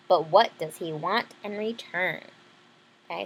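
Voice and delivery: ordinary delivery, nothing marked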